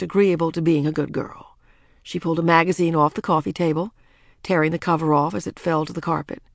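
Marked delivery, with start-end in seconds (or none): none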